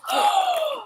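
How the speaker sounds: high, drawn-out mock gasp; high storytime gasp. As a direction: gasp for the listeners at the picture. Reader reacts to angry picture.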